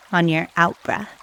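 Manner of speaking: ordinary talk, nothing marked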